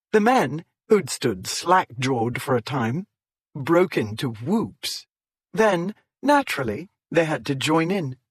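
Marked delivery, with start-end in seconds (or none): none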